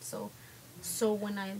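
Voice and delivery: ordinary talk, nothing marked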